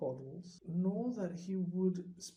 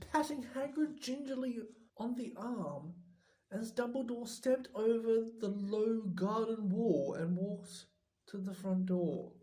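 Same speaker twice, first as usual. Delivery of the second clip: plain reading voice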